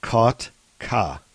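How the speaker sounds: Kotka split into Kot and ka; drawn-out Kotka with a gap before ka; syllable by syllable. Shown as a demonstration. Teaching the name syllable by syllable.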